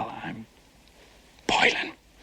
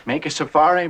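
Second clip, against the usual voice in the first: ordinary delivery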